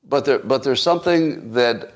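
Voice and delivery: usual speaking voice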